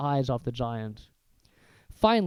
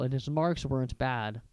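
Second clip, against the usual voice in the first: ordinary delivery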